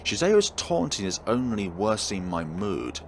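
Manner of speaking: ordinary delivery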